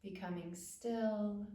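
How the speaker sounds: calm, spacious phrases with long pauses; unhurried body-awareness meditation voice; guided meditation. Acted. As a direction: guide attention gently through the body.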